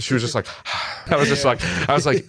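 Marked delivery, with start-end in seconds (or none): 0.5-1.1 imitated soft breath out; breathy exhale. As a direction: breathe out softly, mimicking her. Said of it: "like, breathed".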